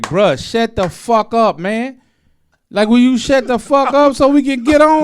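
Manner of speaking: exasperated shout at friend. Retold or imitated. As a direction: snap at your friend to shut up. Fed up with friend mouthing off.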